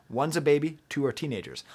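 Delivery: normal talking voice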